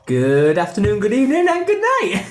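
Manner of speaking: sung-out high "good night" like Truman; bright sign-off, rising into song; Truman Show sign-off. Retold or imitated. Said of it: "From Truman Show".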